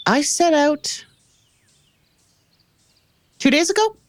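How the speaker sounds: languid, self-satisfied reply; lazy arrogant nobleman. Acted. Guide NPC answers.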